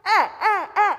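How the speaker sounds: "Eh, eh, eh" high and loud; loud with microphone. Shown as a demonstration. Shy student turned up through the microphone. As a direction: belt it out into the mic. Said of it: "you turned on their volume".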